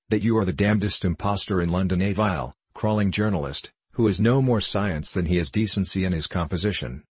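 usual speaking voice

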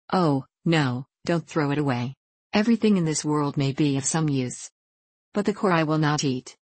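usual speaking voice

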